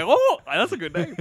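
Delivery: loud, high mimicry of someone's reaction; mock delighted reaction. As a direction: tease them with an exaggerated high "oh". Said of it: "I like your face. You're like".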